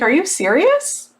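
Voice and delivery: pitched high in mock disbelief; imitating her own stunned reply. She retells answering the sales guy, in disbelief.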